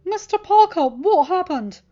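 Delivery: high, loud worried principal; a headteacher rushing in, alarmed; concerned principal. A narrator's reading. Principal demanding answers from the hurt student, high and alarmed.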